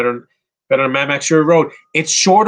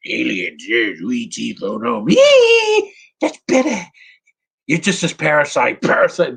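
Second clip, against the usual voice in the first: cartoon character voice; 3 semitones higher than usual. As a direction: ham it up like a silly cartoon. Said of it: "he's not talking like a cartoon character".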